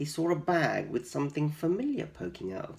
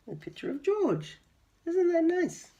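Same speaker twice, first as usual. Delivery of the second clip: delighted teacher. Real, not acted. Teacher delights over picture.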